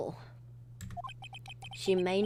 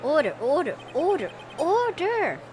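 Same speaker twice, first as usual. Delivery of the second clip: louder, rising judge's call for order; 6 semitones higher, 5 dB louder than usual. Acted. The judge calling the court to order, louder and rising. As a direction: bang the gavel with your voice.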